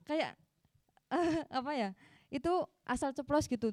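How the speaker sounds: normal talking voice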